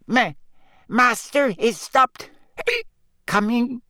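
high, slow, hiccuping elf; slurred high elf with hiccups; drunk house elf. A narrator's reading.

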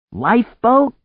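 loud, high rising question; high-pitched, questioning yell; incredulous shout. A narrator's reading. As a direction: yell it back in disbelief. Startled question shouted in storm.

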